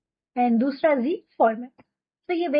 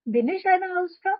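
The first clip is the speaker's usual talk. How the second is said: higher, slow asking tune on bin ich eine Hausfrau; question tune; 6 semitones higher, much slower than usual. Shown as a demonstration. Teacher modelling the question form for students, higher. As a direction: ask it, pitch lifted. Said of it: "क्या मैं हूँ".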